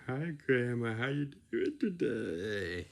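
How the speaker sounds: a hint of the silly greeting voice; lightly put-on greeting; toned-down silly voice; milder silly tone. Shown as a demonstration. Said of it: "You don't go like this".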